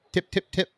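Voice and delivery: light, high little knocking taps; three dainty, separate taps; demonstrated polite knock. Shown as a demonstration. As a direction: show a neat, restrained door knock. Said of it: "That's the knock you do".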